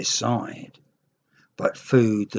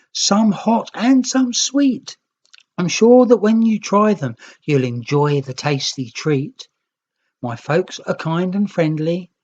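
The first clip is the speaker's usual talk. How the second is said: put-on raised, coaxing child voice; young Green's invitation; 6 semitones higher, 3 dB louder than usual. A narrator's reading. Young Green inviting the hungry Red home, coaxing. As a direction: lure them home with a friendly offer. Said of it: "The Green Nicks replied".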